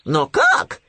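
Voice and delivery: surprised. A narrator's reading. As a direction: burst out with a puzzled question. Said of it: "удивился Хрюня". (удивлённо, громко)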